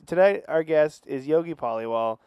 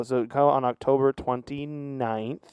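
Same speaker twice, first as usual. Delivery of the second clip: slow nervous plug; 3 dB quieter, slower than usual. Real, not acted. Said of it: "But you were so nervous".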